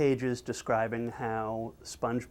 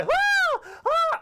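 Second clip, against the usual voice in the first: piercing, very high loud shriek; cartoon scream; 5 dB louder than usual. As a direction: scream at full pitch like a terrified cartoon. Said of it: "It's still going on".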